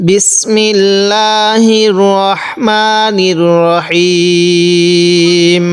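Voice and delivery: slow, drawn-out chanted recitation; Quranic recitation. Shown as a demonstration. Teacher opening the lesson, slow chanted recitation. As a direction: intone each syllable long and even. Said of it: "opening recitation of the lesson".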